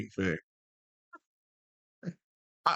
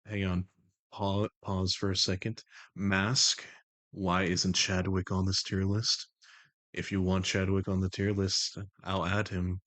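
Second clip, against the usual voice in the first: low, measured, even put-on voice; The Voice, composed and commanding; 4 semitones lower, slower than usual. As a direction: keep it low and level, in unhurried control. Holds The Voice.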